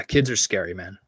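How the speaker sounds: ordinary delivery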